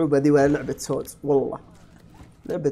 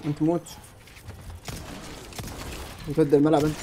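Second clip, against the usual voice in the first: ordinary talk, nothing marked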